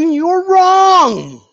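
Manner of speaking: taunting. Acted. Taunting fans.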